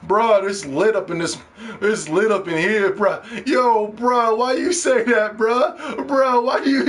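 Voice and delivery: mocking players. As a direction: imitate hype gamer bros, drawing out the slang. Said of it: "That's how they talk. I don't talk like this".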